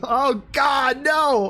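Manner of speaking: high, loud cry of dismay; shocked shout. Real, not acted. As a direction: yell "Oh God, no!" in real horror. Reader shocked by the memory.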